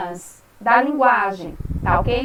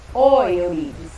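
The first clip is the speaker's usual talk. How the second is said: ordinary talk, nothing marked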